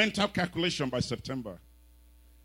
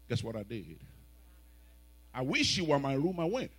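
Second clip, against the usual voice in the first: ordinary delivery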